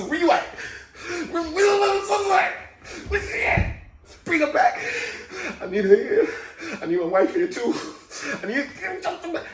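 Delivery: high, swooping hammed-up wail; mock wailing. Patient calls after the nurse and his wife, mock wailing. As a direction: call out for her in a breaking mock wail. Acts out calling for sister and wife.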